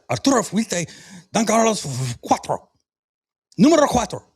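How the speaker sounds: exaggerated Spanish accent, climbing; mock Spanish accent. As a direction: show off the Spanish pronunciation. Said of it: "Stop trying to show off".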